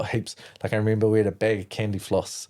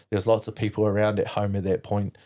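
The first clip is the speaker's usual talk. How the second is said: ordinary delivery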